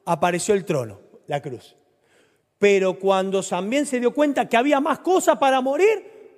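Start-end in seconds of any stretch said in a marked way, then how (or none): 2.6-6.4 high, loud climactic preaching; preaching at full force. Acted. Preacher pressing the congregation at the climax, high and loud. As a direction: drive the question home to the crowd.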